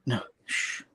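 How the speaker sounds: soft whispered shh; hushing whisper. Retold or imitated. Goblin Queen hushing Peter with a whispered shh. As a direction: hush him like a secret.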